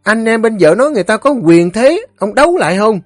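very high, rapid nagging tirade; scolding wife. A narrator's reading. Wife scolds her drunken husband for picking fights, scolding. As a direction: berate him shrilly, barely pausing.

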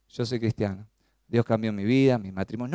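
low, soft, listless mock testimony; unenthusiastic. Shown as a demonstration. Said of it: "No, hágalo con entusiasmo".

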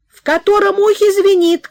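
high, loud impatient shout; mother shouting. Acted. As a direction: shout it again, demanding. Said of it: "кричала мать". (мать нетерпеливо кричит)

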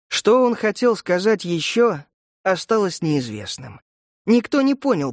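usual speaking voice

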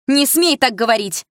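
loud, high, slow shout; furious shout. A narrator's reading. Mother shouting at her daughter, furious. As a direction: forbid it with all force.

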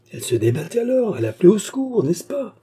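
high, agitated, climbing to a plea; distraught questioning. A narrator's reading. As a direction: beg for confirmation, pitch rising. Distraught lover begs for reassurance. (questions affolées, voix très haute)

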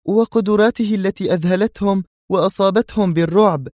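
high female voice, wary and careful; cautious woman. Acted. Zosha cautiously warning Yuri about the man. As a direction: warn him gently but firmly. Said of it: "قالت زوشا في حذر".

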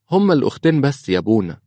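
put-on high, quick child's voice; little girl. Daughter tells the priest who saw mama, child voice. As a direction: pitch it up like a small child replying fast. Child answers her father's question.

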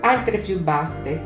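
ordinary delivery

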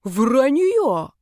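indignant denial. Acted. Friend denies the accusation.

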